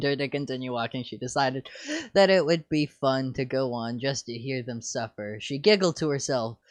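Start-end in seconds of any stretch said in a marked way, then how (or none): none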